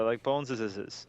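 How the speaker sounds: Boneses said slowly as bone-zez-iz-iz; stretched plural. Shown as a demonstration. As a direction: drag out Boneses with extra iz endings. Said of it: "You can even do it, like, three times over".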